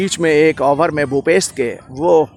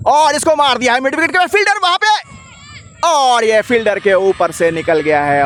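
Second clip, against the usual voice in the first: raised excited call; excited commentary; 8 semitones higher than usual. Real, not acted. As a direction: call the boundary with rising excitement. Big shot over fielder.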